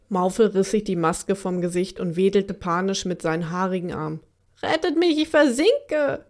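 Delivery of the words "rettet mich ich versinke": sinking monster shrieking for rescue; desperate cry. Acted. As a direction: plead like you're sinking in the mud. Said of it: "schrie er verzweifelt".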